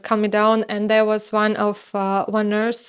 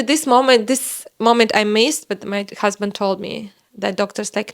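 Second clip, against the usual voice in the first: ordinary talk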